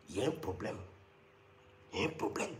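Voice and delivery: low, soft, ominous murmur; whispered mock warning; mock grave murmur. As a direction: drop to a grave undertone.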